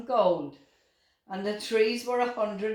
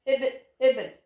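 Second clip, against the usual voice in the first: higher mock croak; croaked attempt; 6 semitones higher, 4 dB louder, faster than usual. A narrator's reading. Owlet copies the frog's ribbit, mock croak. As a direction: try to croak like the frog. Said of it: "she croaked".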